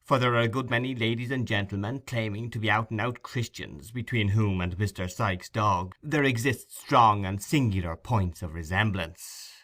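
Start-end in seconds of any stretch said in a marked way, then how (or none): none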